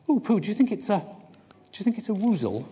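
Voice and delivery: very high, excited piglet squeak; a tiny pig thrilled and a bit scared; squeak of excitement. Acted. Piglet asking Pooh about the woozle, excited squeak. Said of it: "He gave a little squeak of excitement".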